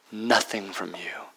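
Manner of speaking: softly whispered. Acted. Preacher telling the congregation grace needs nothing, whispered. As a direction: breathe it out quietly.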